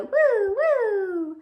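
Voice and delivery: high, loud playful woo woo. Acted. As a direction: whoop like a spinning yo-yo. Yo-yo noises in the rhyme.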